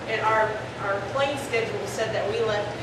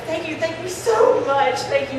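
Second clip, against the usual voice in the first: effusive thanks; 6 semitones higher, 6 dB louder than usual. Retold or imitated. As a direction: re-enact overwhelming relief, stretching the words. Retold relieved thanks to the helpers.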